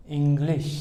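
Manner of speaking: इंग्लिश said as in Devanagari, with ng; teacher's model word. Shown as a demonstration. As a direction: say इंग्लिश carefully. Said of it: "इसको अगर हम हिंदी या देवनागरी में लिखें".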